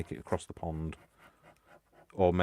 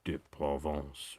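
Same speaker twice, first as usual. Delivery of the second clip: slow, lower mock French accent on Provence; 4 semitones lower, much slower than usual. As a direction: drop down and ham up the French. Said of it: "go down on a couple of octaves".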